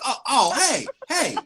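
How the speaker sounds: playfully acted sweet surprise; cutesy greeting. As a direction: greet a cute little rodent. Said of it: "a friendly small rodent that is kinda cute".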